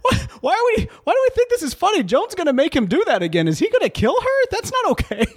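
high, incredulous protest breaking into laughter; alarmed disbelief. Acted. Asking why the threat is funny, alarmed disbelief. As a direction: protest in squeaky mock outrage.

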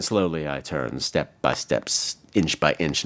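very low, slow and softened; slow, ominous recitation. Acted. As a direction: creep through it, phrase by phrase. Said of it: "I gave her a slow turn".

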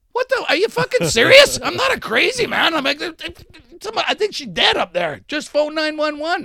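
high, loud desperate pleading; yelling at the door for help; retold pleading shout.

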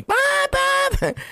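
high-pitched "pop, pop"; mock popping noise. Storyteller adding sound effects to his pool tale, high popping noises. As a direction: squeak out two quick high pops. Imitates pops while telling.

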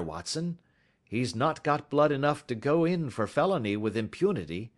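lightly voiced commanding detective; Sherlock Holmes. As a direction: take charge briskly, then eye him with mild scorn. Voices Holmes giving orders.

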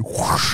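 voiceless sliding swoosh; imitated whoosh. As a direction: mouth the sound of a phone skidding away. Imitates phone sliding across floor.

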